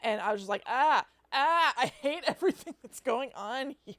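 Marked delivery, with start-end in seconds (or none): 0.7-1.7 loud, high acted-out yelps; mock scream of sensory overload; overwhelmed cries. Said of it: "I just get overwhelmed by my senses". 1.8-3.9 overwhelmed. Retold or imitated. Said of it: "I just get overwhelmed by my senses".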